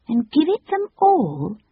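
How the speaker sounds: put-on high, slow small-girl voice; an astonished little girl; Jane. A narrator's reading. Jane questions Cyril, in a high small-girl voice.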